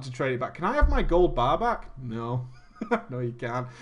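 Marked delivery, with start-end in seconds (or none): 2.0-2.5 deadpan refusal. Retold or imitated. Acted refusal. 3.1-3.7 низкий невозмутимый отказ; deadpan low refusal. Retold or imitated.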